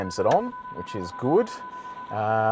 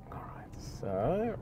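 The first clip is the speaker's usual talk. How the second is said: soft hushed whisper under engine; 5 semitones higher, 14 dB quieter, slower than usual. Real, not acted. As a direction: whisper it, breathless anticipation. Quiet aside while accelerating.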